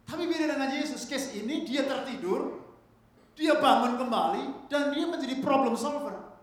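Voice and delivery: high, loud sermon climax; full-force pulpit delivery; impassioned preaching. Real, not acted.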